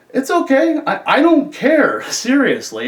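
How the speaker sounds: amused reassurance. Retold or imitated. Officer telling inmate he doesn't care, amused. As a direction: wave it off loudly, pitch way up.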